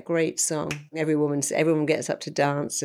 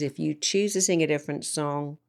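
usual speaking voice

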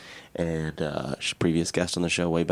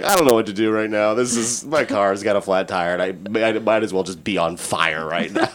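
louder, half-laughing replay of his panic; mock panic; 3 semitones higher, 6 dB louder than usual. Guy telling co-hosts about his flat tire, mock panic. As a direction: ham up the roadside meltdown.

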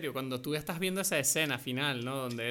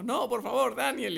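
pleading; 8 semitones higher, slower than usual. Retold or imitated. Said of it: "Es como".

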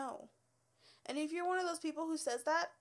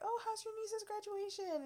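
bright friendly question; 9 semitones higher than usual. Retold or imitated. Her own bright question to client.

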